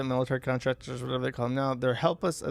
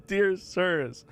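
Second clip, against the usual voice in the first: laughing; 4 semitones higher, much slower than usual. Acted. Starts reading letter, laughs at it.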